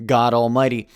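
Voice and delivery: ordinary talk, nothing marked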